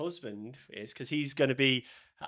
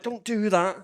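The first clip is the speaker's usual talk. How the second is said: higher mock protest; exasperated plea to stop; retold objection; 8 semitones higher, 4 dB louder, slower than usual. Retelling reaction to a bad sketch, mock protest. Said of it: "stood there like".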